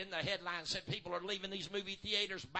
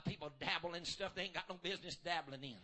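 usual speaking voice

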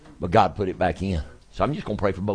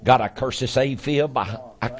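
laying a curse; 4 semitones higher than usual. Real, not acted. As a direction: command the illness out in prayer. Praying over the sick man.

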